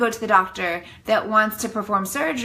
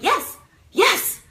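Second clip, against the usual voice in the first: 1 eager yes; 11 semitones higher than usual. Retold or imitated. Relief at the nurse's alarm.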